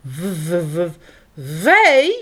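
nervous stutter rising to a squeak. A narrator's reading. Hedgehog faces strange animal, nervous stutter. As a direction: stammer out the first word. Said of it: "stottert de egel".

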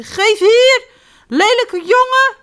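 high, loud angry yell; shouting girls. Acted. The two girls yell at Jip, high and angry. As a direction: yell it at the naughty boy. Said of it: "roepen de twee vriendinnetjes".

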